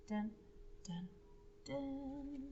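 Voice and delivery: dramatic ellipsis sting; spooky drumroll voice; suspense dun dun dun. Shown as a demonstration. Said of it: "what we do when we see an ellipsis".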